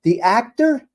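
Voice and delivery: high, loud rising question; incredulous. Real, not acted. Streamer mocking the news word for criminals, incredulous. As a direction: throw the word back in disbelief.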